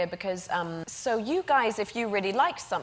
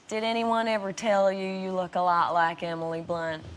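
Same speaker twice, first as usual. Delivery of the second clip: put-on Southern accent. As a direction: play a curious local with a Southern twang. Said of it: "a little bit Southerny".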